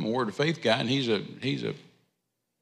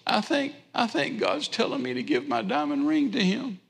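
high-pitched mock tearful voice; imitated crying; 11 semitones higher than usual. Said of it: "she's crying, and she said".

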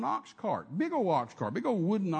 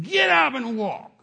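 slow, loud, higher command; 6 semitones higher, 7 dB louder, slower than usual. Retold or imitated. Preacher commanding the paralyzed man to rise, loud. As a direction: order him up with full force. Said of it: "I say".

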